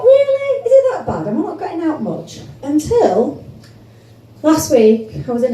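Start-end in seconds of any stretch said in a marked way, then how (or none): 0.0-2.4 high, disbelieving squeak; hurt surprise, voice shooting up; really, is it that bad; incredulous. Incredulous inner reaction, high pitch.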